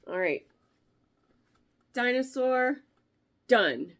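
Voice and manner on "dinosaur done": excited announcement. Real, not acted. Announcing a finished project.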